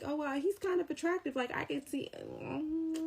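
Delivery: high, soft, far mock gushing voice; mocking swooning girls. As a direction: ham up the starry-eyed girls. Mocking girls who find him attractive.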